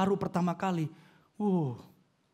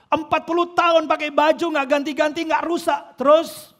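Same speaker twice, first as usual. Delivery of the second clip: raised, emphatic preaching voice; preaching loud and high; 8 semitones higher, 8 dB louder than usual. Real, not acted. Preacher to congregation, loud and high emphatic. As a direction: drive the wonder home to the congregation.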